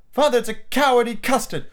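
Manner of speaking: put-on high-pitched boy teasing father; taunting child. Son calls father a coward, taunting.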